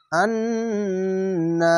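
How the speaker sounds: আন্না with the নুন held and hummed long, very slow; nasal hold. Shown as a demonstration. Teacher showing the held gunna on আন্না, very slow. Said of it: "এখানে ওয়াজিব গুন্না".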